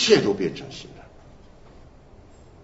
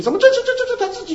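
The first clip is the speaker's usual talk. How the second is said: mock bafflement; 4 dB louder, faster than usual. Mock puzzlement at contradiction.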